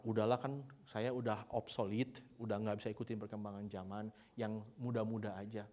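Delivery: low, soft, giving-up voice; mock resignation. Grandparent declines to teach the young, quietly resigned. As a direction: beg off quietly, as if past it. Acts elderly person giving up.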